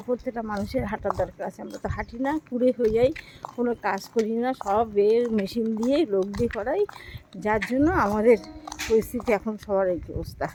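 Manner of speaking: ordinary talk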